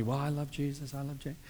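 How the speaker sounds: mock prisoner mumbling I love Jesus; mock death row testimony. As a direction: imitate a convict's hollow testimony. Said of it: "they give a little testimony".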